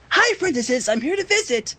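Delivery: high, bubbly pony greeting; a hint of excited Pinkie; lightly voiced Pinkie. A narrator's reading. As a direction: chirp it like a cheerful pink pony. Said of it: "Pinky voice".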